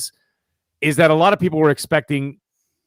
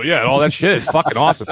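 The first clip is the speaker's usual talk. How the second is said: usual speaking voice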